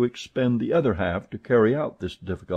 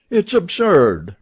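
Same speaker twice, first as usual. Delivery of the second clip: higher, louder, slower exclamation; cried out; 9 semitones higher, 8 dB louder, slower than usual. A narrator's reading. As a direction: burst out in disbelief. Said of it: "cried Stuart".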